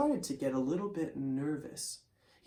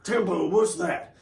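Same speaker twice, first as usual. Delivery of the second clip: lightly voiced sleepy cowboy; just woken, mumbling it out; groggy cowboy; 4 dB louder than usual. Wild Bill, half asleep, answers Tempo in a light groggy cowboy voice. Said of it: "so he was groggy".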